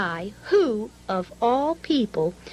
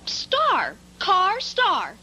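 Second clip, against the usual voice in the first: much higher, pleased discovery; proud answer; 7 semitones higher, much slower than usual. Real, not acted. Child finding a rhyme for car, calling it out high. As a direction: call out the rhyme triumphantly. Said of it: "child solving rhyme".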